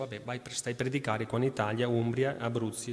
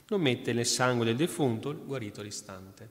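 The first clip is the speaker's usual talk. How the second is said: ordinary talk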